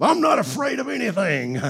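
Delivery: hammed-up angry denial; mock angry retort. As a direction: protest too much, mock-defiant. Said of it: "because people get mad at me".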